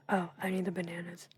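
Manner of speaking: ordinary talk, nothing marked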